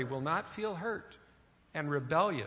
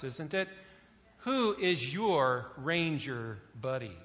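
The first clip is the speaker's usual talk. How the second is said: ordinary delivery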